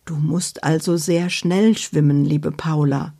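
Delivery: plain reading voice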